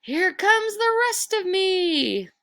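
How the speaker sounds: high, slow, drawn-out ghost wail; ghostly wail. Acted. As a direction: wail it down the chimney like a lost spirit. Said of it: "warned the voice".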